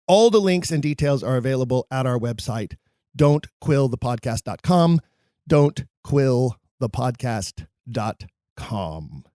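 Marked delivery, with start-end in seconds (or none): 5.5-9.2 slow, word-by-word web address; slow repeat. Real, not acted. Host repeats the website for listeners, slow and measured. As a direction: spell it out slowly, pausing between words.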